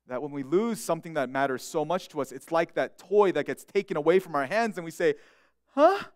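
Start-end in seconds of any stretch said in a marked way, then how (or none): 5.7-6.0 put-on high, louder indignant child's protest; whiny child. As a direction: complain like a child whose toy was taken. Child-like complaint to God.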